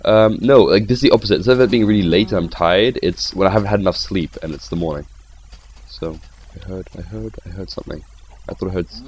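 usual speaking voice

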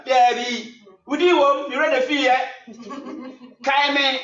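loud, high, punchy calls; acted insistence. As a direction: press a reluctant volunteer loudly. Acts insistent exchange with reluctant volunteer.

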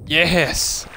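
sudden loud burst of relief; triumphant yes. Real, not acted. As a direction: cheer at surviving the fall. Survives a big jump.

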